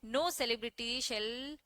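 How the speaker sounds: धीरे धीरे, हर शब्द अलग करके बोलो; लिखवाने वाले अंदाज़ में बहुत धीमे; slow dictation. Shown as a demonstration. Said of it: "लिख लो ये स्टेटमेंट". (छात्रों को नोट्स लिखवाते हुए धीमी आवाज़)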